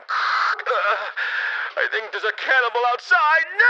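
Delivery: high, pained and pleading, some words slow and one yelled; hammed-up panicked castaway on the radio; desperate radio call. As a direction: act out a terrified survivor alone in the dark. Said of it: "like, on the radio, like".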